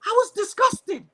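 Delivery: high, drawn-out, upset; real tearful disgust. Real, not acted. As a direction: slowly push it out through tears. Storyteller breaking down as she relives.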